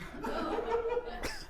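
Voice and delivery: wheezing out-of-breath gasp; hammed-up heavy panting; breathy wheeze. Shown as a demonstration. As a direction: gasp like Mark Wahlberg winded. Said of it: "out of breath Mark Wahlberg".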